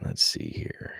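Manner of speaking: whispered aside while looking; barely voiced, absent-minded; muttering to self. Real, not acted. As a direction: mumble it under your breath. Murmurs to self while searching.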